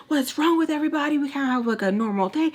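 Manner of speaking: high, quick outburst easing down; imitated exasperation. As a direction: act out a mad complaint, starting high-pitched. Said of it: "He's all mad about it".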